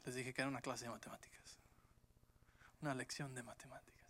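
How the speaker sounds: softly whispered joke; whispered aside. Real, not acted. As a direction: slip it in as a secret aside. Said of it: "unvoiced whisper aside". (susurro en broma)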